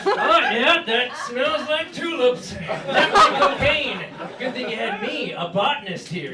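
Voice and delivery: high, loud, smug expert act; mock expert. Joking that he'd sniff like an expert, in a high loud smug voice. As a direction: play the know-it-all botanist. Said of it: "I was gonna be like".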